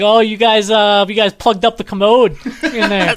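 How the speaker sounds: high, loud yinzer landlord; landlord's Pittsburgh accent. Retold or imitated. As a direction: greet the tenants like a Pittsburgh landlord. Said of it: "he was like".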